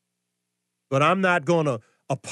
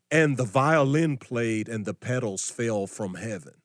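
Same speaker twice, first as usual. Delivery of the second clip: softer, lower, syrupy mock piety; mock sweet; 6 semitones lower, 3 dB quieter than usual. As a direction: sneer at it by going soft and dreamy. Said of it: "there's no passage in the Bible and says".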